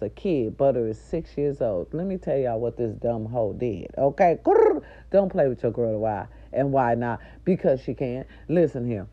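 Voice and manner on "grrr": high loud frustrated growl; angry growl. Real, not acted.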